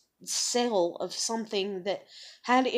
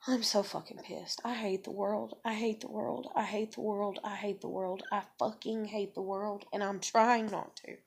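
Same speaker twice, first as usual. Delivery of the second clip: quiet seething; 2 semitones lower, 10 dB quieter than usual. Real, not acted. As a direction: let real rage simmer quietly, over and over. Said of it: "I'm so goddamn mad".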